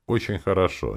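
usual speaking voice

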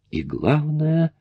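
звенящий, высокий и медленный голос; «главное» звенит высоко; ringing voice. A narrator's reading. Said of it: "голос его зазвенел". (звенящим голосом)

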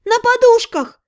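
high, loud call back; running off and shouting back to mom; shouted reply. A narrator's reading. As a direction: shout it over your shoulder while running. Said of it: "прокричала я".

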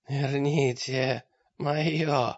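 slow, strained voice of a throttled mage; choked mage. A narrator's reading. Choked mage glares with hatred at his attacker, strained. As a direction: drag the words out through a squeezed throat.

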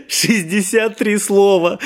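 laughing out the words, slowly and high; delighted gasp. A narrator's reading. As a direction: marvel with a laugh. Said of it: "восхищённо ахнул Николка".

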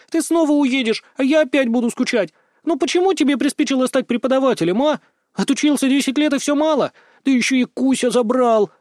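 high-pitched petulant protest; angry girlish complaint. A narrator's reading. Sister reproaching brother for leaving, angry and petulant. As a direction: scold him in a high, aggrieved girl's voice. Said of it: "гневно взглянула на меня и сжала кулаки".

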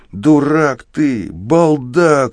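high, drawn-out insults; furious shout. A narrator's reading. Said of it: "кричу я". (яростный высокий крик)

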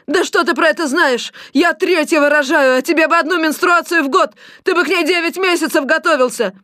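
high-pitched angry tirade; outraged wail. A narrator's reading. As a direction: scold him at the top of your range. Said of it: "завопила соседка". (возмущённая крикливая тирада)

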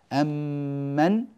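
very slow أم من with one long m; merged meem. Shown as a demonstration. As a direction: hold the doubled meem. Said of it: "بميم واحدة مشددة".

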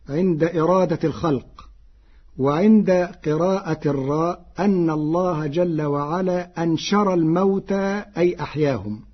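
normal talking voice

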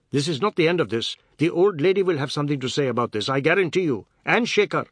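high, loud, emphatic warning; indignant Biswas, threatening. A narrator's reading. Biswas threatening Seth unseen from the veranda, indignant. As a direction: warn them loudly from a distance.

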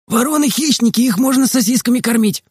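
put-on high-pitched boy's call from a window; Makar calling out. A narrator's reading. Makar calls to the boys from the window, high calling voice. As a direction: call it out high, without real shouting volume. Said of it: "крикнул из окна Макар".

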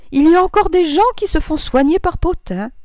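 high, loud cry of disbelief; incredulous exclamation. A narrator's reading. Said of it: "s'écria madame Verdurin". (s'exclamer haut et fort, incrédule)

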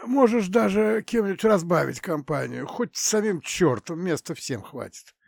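high hoarse rasp; hoarse wheeze. Acted. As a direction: rasp it out with a lost voice. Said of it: "прохрипел Александр".

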